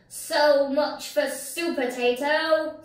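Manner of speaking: loud high villain screech. Acted. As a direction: screech it gloating. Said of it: "screeched the pea".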